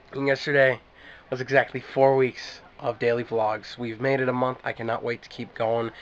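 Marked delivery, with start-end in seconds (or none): none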